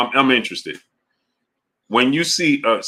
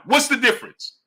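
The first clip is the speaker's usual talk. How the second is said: exasperated; 11 semitones higher than usual. Real, not acted. Heated argument with chatter.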